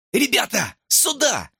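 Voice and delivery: high-pitched, urgent call. A narrator's reading. Hamster summoning his friends, calling out high. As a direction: call the gang over in a high voice. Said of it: "крикнул хомяк".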